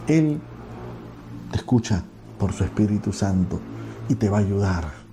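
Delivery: normal talking voice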